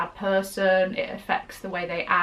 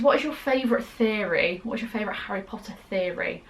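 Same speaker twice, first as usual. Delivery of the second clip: ordinary talk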